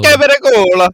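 high, loud mock-Italian accent. Friend guessing how Italians say it, mock Italian. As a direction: belt it out in exaggerated Italian. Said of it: "Like that, probably".

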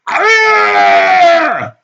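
high, very loud madman's scream; long wild yell at full volume. A narrator's reading. Shirtless madman flails his arms, screaming. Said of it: "continued to scream".

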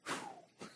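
a long breathy exhaled sigh; demonstrated sigh. Shown as a demonstration. Preacher shows the congregation a sigh, long exhale. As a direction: let it all out in one sigh. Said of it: "let out this low frequency sound that we call a sigh".

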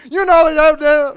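loud, high garbled Southern voice; unintelligible Southern accent. Retold or imitated. Diner man answering two baffled fishermen, garbled Southern accent. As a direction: blurt it in a mush-mouthed Southern accent. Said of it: "you could not fucking understand him".

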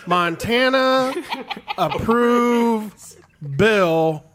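very slow, drawn-out words with long gaps; mocking halting reading. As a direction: imitate a co-host stumbling through a headline. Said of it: "She goes".

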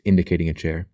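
plain reading voice

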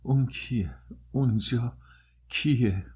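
halting choked words with pauses between; a dying man's stifled, startled question; muffled, choked voice. A narrator's reading.

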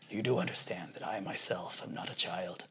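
low, hushed, grave movie-star delivery; Liam Neeson. Retold or imitated. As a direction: imitate a deadly serious customer, quietly. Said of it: "Liam Neeson was fantastic".

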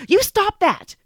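high, loud stern command; stern scolding. Retold or imitated. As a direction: snap it like a scolding teacher. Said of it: "and she says".

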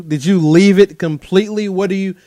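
ordinary talk

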